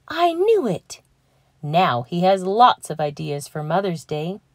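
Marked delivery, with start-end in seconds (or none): 0.1-1.0 delighted realization. Acted. Llama realises Mama's wish.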